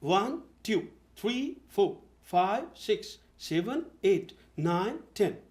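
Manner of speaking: वन, टू in measured pairs, टू as "tyu"; correct counting. Shown as a demonstration. Coach models how counting should sound, paired tones. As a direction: count वन to टेन two by two, crisp consonants, silent r in फोर. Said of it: "counting को ऐसे बोला जाता है".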